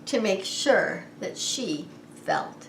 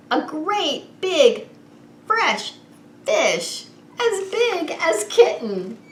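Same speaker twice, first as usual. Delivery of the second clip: higher and louder, big slow wonder; excited reveal; 7 semitones higher, 5 dB louder, slower than usual. A narrator's reading. Reading to children, revealing the fish with amazement. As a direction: sell the surprise to the kids.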